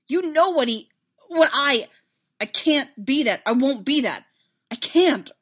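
loud, high, broken protest; distraught, emphatic denial; desperate refusal. Acted. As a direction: refuse it frantically, breaking off. Anguished refusal in a quarrel.

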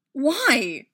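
loud, high demand; sharp, forceful one-word challenge. A narrator's reading. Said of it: "he demanded".

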